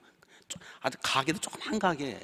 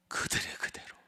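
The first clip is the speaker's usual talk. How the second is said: whisper. Real, not acted. Marvelling aside.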